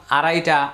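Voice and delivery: আড়াইটা run together quickly as one everyday word; casual, unstretched আড়াইটা, like the number word; everyday Bengali pronunciation. Shown as a demonstration. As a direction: say আড়াইটা fast, with a light Bengali flap. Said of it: "এইভাবে যেন উচ্চারণ না হয়".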